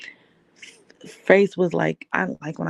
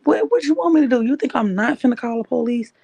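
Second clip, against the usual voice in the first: desperate cry; 6 semitones higher than usual. Retold or imitated. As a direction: throw the question back at him, near breaking. Confronting abusive partner.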